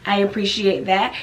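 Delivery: normal talking voice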